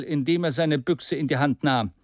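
ordinary delivery, nothing marked

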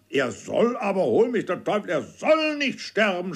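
high, heated outcry with an oath; shouting. A narrator's reading. Uncle Toby shouts down Trim's gloom, high and heated. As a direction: burst out, swearing he won't die. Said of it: "schrie mein Onkel Toby".

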